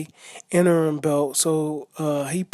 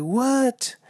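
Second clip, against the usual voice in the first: shocked what; 8 semitones higher than usual. Retold or imitated. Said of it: "I was shocked".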